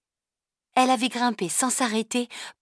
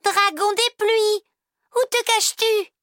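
put-on a girl calling again into the cave, higher and louder; 5 dB louder than usual. A narrator's reading. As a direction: call out again, longer and higher. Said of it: "Limeil appela encore".

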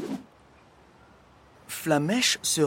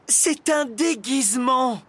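high, loud, slowed-down explanation; exasperated explaining; 12 semitones higher, 5 dB louder than usual. A narrator's reading. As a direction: spell it out, raised and drawn out. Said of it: "lui expliqua-t-elle". (explication exaspérée, appuyée)

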